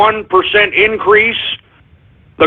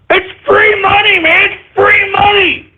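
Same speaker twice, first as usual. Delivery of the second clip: much higher, cracking shout of frustration; real exasperated cry-shout over missed profits; pissed-off outburst; 11 semitones higher, slower than usual. Real, not acted. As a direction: yell it like money just slipped through your fingers. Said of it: "I'm pissed off about that".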